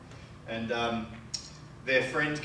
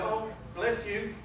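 mock sympathy; 7 semitones higher, 5 dB louder, much slower than usual. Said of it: "I'd go".

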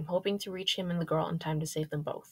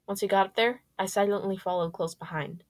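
ordinary delivery

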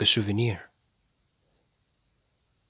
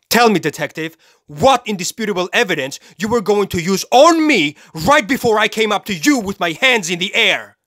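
high, raised-voice tirade at its peak; angry demand; 8 semitones higher, 4 dB louder than usual. Acted. Killer challenges the detective's lack of evidence, furiously demanding. Demands answer, rant peaks.

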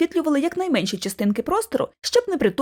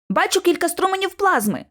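raised pitch, sudden mild cry; sudden exclamation; 7 semitones higher than usual. A narrator's reading. George alerts the others to plasma streams, sudden exclamation. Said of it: "зненацька вигукнув Джордж".

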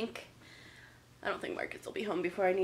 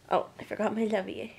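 ordinary talk, nothing marked

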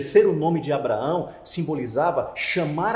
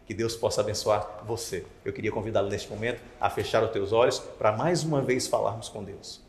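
usual speaking voice

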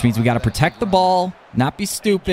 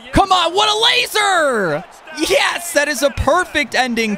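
excited yell; 14 semitones higher than usual. Real, not acted. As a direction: yell with joy at the pass. Big completion in the game.

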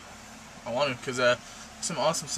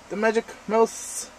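high, slow showy announcement; 9 semitones higher, 5 dB louder than usual. Real, not acted. Showing off her mouse.

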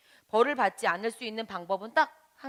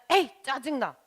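higher, louder irritated outburst; acted annoyance; 4 semitones higher, 6 dB louder than usual. Teacher showing children a peevish friend, annoyed. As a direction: let out a burst of mock annoyance. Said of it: "짜증이 나면".

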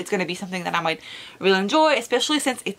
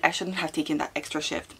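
usual speaking voice

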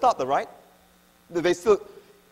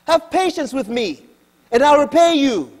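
pleading; 7 semitones higher, 6 dB louder than usual. Acted. Fellow slave pleading again.

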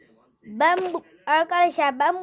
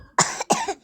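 high squeaky fake cough; hammed-up cough. Sick character coughing mid-sentence, hammed-up. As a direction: cough theatrically.

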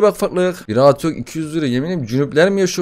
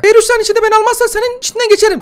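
put-on much higher, louder cheeky baby threat; a tiny brat swearing; squeaky baby voice; 6 dB louder, faster than usual. Gamer voices the demon baby cursing him, squeaky.

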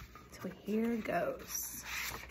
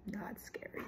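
usual speaking voice

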